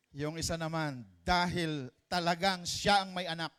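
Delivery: usual speaking voice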